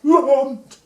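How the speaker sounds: high, slow, indignant cry; mock angry accusation. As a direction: hurl it at God, hammed-up cross. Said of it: "he got cross with God".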